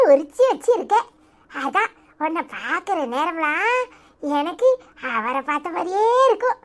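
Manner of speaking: granny melting toward her grandson; tender grandmother. Acted.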